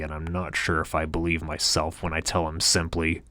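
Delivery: usual speaking voice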